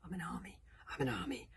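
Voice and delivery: soft, repeated self-convincing mantra; whispering to himself. A narrator's reading. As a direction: whisper it under your breath. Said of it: "he was whispering over and over".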